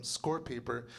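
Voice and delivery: ordinary talk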